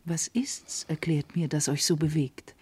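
soft, whispered concern; worried whisper. Acted. Karis to the upset princess, whispering. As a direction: whisper the question with care. Maid asks princess secretly.

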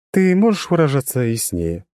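high, even, detached voice; monotone girl. A narrator's reading. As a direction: keep it level and distant. Ан speaks.

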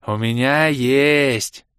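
slow, loud, drawn-out delight; joyful announcement. A narrator's reading. Orbit offers his own bottles, joyfully drawling. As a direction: announce it gleefully, stretching the words. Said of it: "радостно оповестил нас Орбит".

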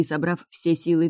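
ordinary delivery

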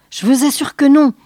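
doctor insists to the doubting general; insistent fright. Acted. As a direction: insist it, still scared. Basset insists, still frightened.

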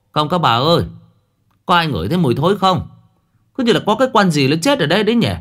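high, fast, loud call. A narrator's reading. Pallbearer calling out to the mourners about a smell, high and quick. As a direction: call out to the women about the stench. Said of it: "ngó vào rồi hô".

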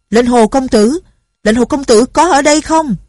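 calling out loud. Acted. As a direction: shout his name to find him. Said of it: "có người gọi". (gọi to, giọng cao)

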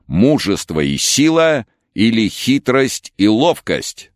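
high, slow herald's proclamation; royal herald. Acted. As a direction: cry it out like an arena herald. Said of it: "Глашатай объявил бойцов так".

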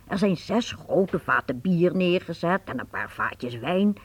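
normal talking voice

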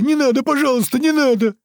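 very high, loud begging; wailing plea. A narrator's reading. Tortured captive begging his attacker to stop, wailing. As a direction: beg shrieking in terror. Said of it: "завопил Толстый".

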